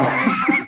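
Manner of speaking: high squeal of a laugh; burst of laughter. Real, not acted. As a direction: laugh at your own punchline. Laughs at own joke.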